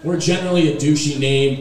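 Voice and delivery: ordinary talk, nothing marked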